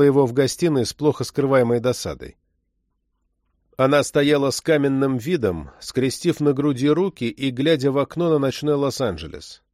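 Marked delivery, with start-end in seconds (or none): none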